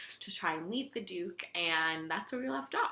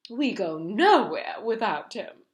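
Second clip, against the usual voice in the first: loud, higher proud introduction; proud giraffe; 6 semitones higher, 8 dB louder, slower than usual. A narrator's reading. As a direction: present him proudly. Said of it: "the giraffe said".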